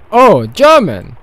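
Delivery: high, very loud, drawn out; loud surprised exclamation. Acted. Wilma realizes the caller speaks German, loud surprised exclamation. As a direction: exclaim it with sudden recognition.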